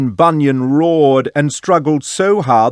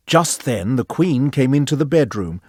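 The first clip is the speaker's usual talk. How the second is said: ordinary delivery, nothing marked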